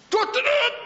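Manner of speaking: high, loud tooted notes; mock trumpet call. Preacher imitating Gabriel's signal for the rapture, mock trumpet call. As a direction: toot like a heralding horn.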